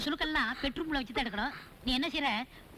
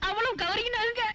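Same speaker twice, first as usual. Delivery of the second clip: sobbing out the words, pitch higher; crying; 6 semitones higher than usual. Acted. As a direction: wail it through tears.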